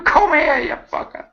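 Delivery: mock chase call. Acted chase after the fleeing officer.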